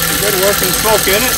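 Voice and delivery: excited. Real, not acted. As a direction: call it out delighted. Pleased the engine smokes.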